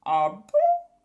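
loud, surprised witch; a witch hearing compliments; flattered witch. A narrator's reading.

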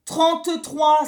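loud, slow irritated demand; losing patience with a rude child; exasperated. A narrator's reading. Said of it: "s'énerva le docteur".

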